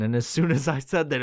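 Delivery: ordinary delivery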